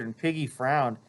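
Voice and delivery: ordinary delivery, nothing marked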